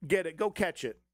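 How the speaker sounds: ordinary talk, nothing marked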